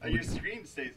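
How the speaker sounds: off mic, distant and faint; speaking away from the microphone; far from mic. Real, not acted. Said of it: "I'm off mic there".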